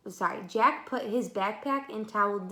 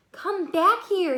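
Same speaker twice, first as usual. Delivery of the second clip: higher, louder, slow call into a tunnel; 9 semitones higher, 7 dB louder, slower than usual. Acted. Jack calls Annie back from the tunnel, drawn-out call. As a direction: call after her down a dark passage.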